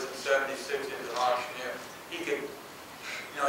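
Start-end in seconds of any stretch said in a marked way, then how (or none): 2.1-3.4 slow, breathless whisper; barely voiced, like a lost voice; voiceless whisper. Father lost his voice.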